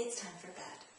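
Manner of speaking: calm, soft and firm, no argument; firm but loving. Shown as a demonstration. Parent putting child back to bed, soft and firm. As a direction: say it gently but leave no room. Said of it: "in a firm but loving voice".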